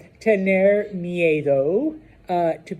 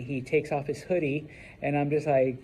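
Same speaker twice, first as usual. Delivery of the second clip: ordinary talk, nothing marked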